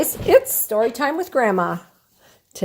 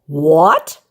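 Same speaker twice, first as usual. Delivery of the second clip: bellowed; 4 semitones lower, 6 dB louder than usual. Acted. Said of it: "he bellowed".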